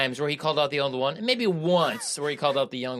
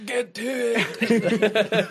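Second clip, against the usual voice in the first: high, drawn-out Scottish holler; angry Scotsman; 6 semitones higher, much slower than usual. Retold or imitated. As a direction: put on an over-the-top angry Scottish accent. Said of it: "He just sounded like an angry Scotsman".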